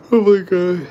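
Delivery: big sleepy sigh before bed; tired sigh. Real, not acted. Tired near bedtime.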